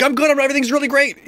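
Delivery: high, loud, fast gushing; overexcited reply. Pretend. Friend answering a greeting, bursting with excitement. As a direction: blurt it out way too excited. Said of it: "I'm not yelling, I'm just really excited".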